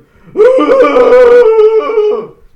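loud, high imitation howl; drawn-out, high dog howl; dog's howling bark. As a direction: howl out a long doggy bark. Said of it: "that's her bark".

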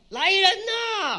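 loud, high call for servants; official's call. Acted. Magistrate calling his servants with a loud, high shout. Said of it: "他说什么呀".

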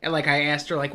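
ordinary talk, nothing marked

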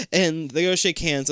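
ordinary talk

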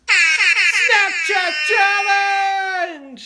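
slow, high, loud game-show announce; announcer shout. Acted. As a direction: boom out the segment title. Announcing the segment.

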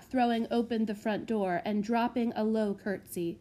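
ordinary delivery

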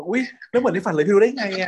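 high, squealing delight on อุ๊ย; mock childlike excitement. Host redoes Ton's line as a giddy excited kid. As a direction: ham up the kid-with-a-toy thrill. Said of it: "ทำเสียงแบบตื่นเต้นเหมือนเด็กได้ของเล่นนะ".

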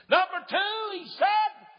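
high, loud sermon announcement; emphatic shout. Real, not acted. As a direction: shout the point out to the congregation. Preacher announcing God's second point.

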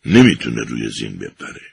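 sorrowful. A narrator's reading. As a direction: sadly break the news. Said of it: "با اندوه سر تکان داد و گفت".

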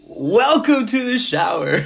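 loud, high hype intro; show intro shout. Acted. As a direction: belt it like a show host. Podcast intro called out.

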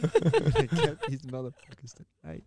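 gleeful laughing boast; loud laughing repeat. Shown as a demonstration. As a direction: belt the threat again through a laugh. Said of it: "Oh, man, I'm gonna get these motherfuckers tonight".

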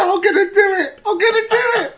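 very high, loud hammed-up cry; mock desperate threat. Mate's joke answer to the counsellor, mock desperation. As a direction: cry it out high in fake despair. Mate acts fake despair on phone.

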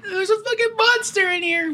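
put-on high-pitched, frightened little-kid voice; a small child insisting there's a monster; scared kid. Frightened child tells dad about the monster, high and scared. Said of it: "I'm like".

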